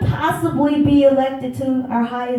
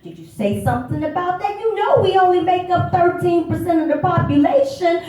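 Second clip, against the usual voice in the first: higher-pitched pointed questioning; indignant challenge; 6 semitones higher than usual. Retold or imitated. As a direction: call them out, pitched up and emphatic.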